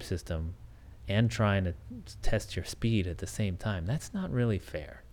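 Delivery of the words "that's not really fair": ordinary talk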